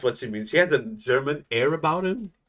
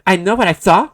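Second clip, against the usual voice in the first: sassy accusation; 10 semitones higher, 6 dB louder than usual. Acted. Attendant accusing loudly.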